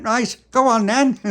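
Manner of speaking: squeaky referee shout; loud high urging. Acted. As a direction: urge them on sharply. Referee urges.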